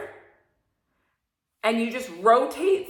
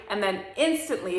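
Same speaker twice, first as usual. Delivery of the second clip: normal talking voice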